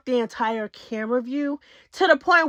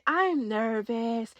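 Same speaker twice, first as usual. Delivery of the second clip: soft, slow, nervous murmur; timid I'm nervous; 3 semitones lower, 7 dB quieter, much slower than usual. Retold or imitated. ET answering Rolly, a soft slow nervous murmur. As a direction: barely get it out, slow and small.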